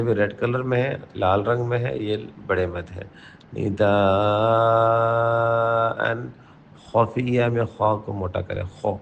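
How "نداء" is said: نداء stretched very slowly, the long aa held out; long madd. Shown as a demonstration. Teacher showing the long madd in red-marked نداء. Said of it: "red colour میں ... زیادہ لمبے کرنے ہیں".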